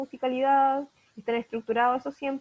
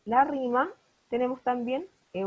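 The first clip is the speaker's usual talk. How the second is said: ordinary delivery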